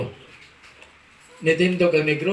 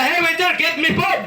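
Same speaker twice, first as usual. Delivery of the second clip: mock shout; 9 semitones higher than usual. American diner demanding food from waiter, high shout. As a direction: bellow the order like an impatient customer. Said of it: "Nisinggit ang".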